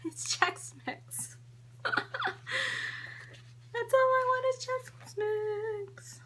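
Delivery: high, slow mock whine; whiny. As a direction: whine it like a kid. Whining about snack.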